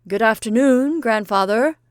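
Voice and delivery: put-on loud, high hello to a deaf old man; a boy calling out a polite greeting. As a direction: raise the voice so grandfather hears.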